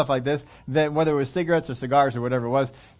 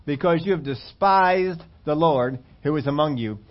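normal talking voice

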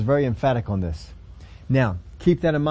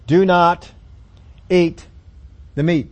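louder and higher, commanding; 5 semitones higher, 6 dB louder, slower than usual. Acted. Preacher voicing God's strict command to priests, loud. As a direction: boom it out as a stern decree.

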